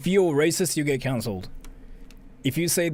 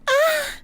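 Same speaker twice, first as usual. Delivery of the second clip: sudden high yelp mimicking otaku moaning; a squeaky high-pitched imitation cry; mocking moan; 4 dB louder than usual. Streamer imitates Akihabara crowd with high yelp. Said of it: "you hear people going like".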